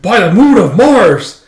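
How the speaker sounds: high, loud, drawn-out exclamation; hammed-up comic-book cry of amazement; acted astonishment. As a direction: draw it out, high and big.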